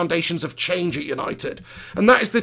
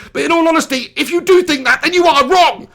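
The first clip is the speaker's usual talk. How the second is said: loud ranting; 7 semitones higher, 4 dB louder than usual. Real, not acted. Heated football opinion rant.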